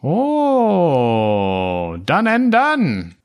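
high slow merchant voice. Acted. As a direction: sing it out like a cheery shopkeeper. GM voices merchant Odo Hups.

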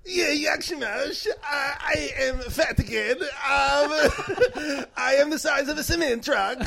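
high, slow bad foreign accent; Borat. Special guest attempting the booked impression, Borat. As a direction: push a drawn-out, high mock accent, near laughter. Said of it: "let's get a special guest attempt".

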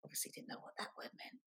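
whispered side comment, very soft and quick; hushed, hurried self-commentary; quiet aside. Real, not acted. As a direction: drop out of the reading to murmur. Aside on her younger writing.

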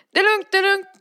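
loud, high strained reassurance; hammed-up sick friend calling out he's okay; insisting he's fine. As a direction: shout it's fine while clearly not.

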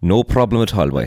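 put-on a quick stab at a Mayo accent, lightly done. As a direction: say it like a country lad from Mayo, half joking. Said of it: "can you be from down the country".

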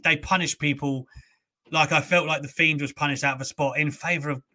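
ordinary talk, nothing marked

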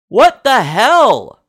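high, loud, drawn-out disbelief; stunned, slow exclamation; incredulous outburst. Real, not acted. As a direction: stretch it out, loud and appalled. Disbelief at Tyson winning again.

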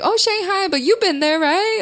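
very high, over-eager imitation of self; starstruck babbling. Comedian replaying chat with a judge, over-eager and high. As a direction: gush it in a mock overfamiliar high voice. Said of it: "I was just saying, like, really crazy things".

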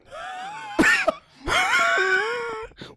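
very high wheezing laugh; wheezing laughter; wheezy laugh. Real, not acted. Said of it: "something in my throat".

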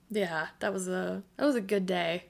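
ordinary talk, nothing marked

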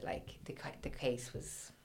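hushed, whispered aside; whispering. Real, not acted. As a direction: drop to a whisper. Whispered detail of the case.

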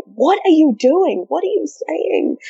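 high, slow, drawn-out disbelief; acted incredulous questioning look put into words; mock bewilderment. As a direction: stretch it out, high and baffled. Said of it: "looked at each other as if to say".

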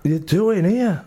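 high, slow, dazed groggy question; mock knocked-out friend coming round; dazed mate. Friend just rolled in a golf cart, dazed, slow and high. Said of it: "And he's like".

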